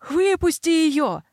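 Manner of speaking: high, slow desperate yell. Acted. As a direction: scream it out in panic. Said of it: "заорал он". (отчаянный крик)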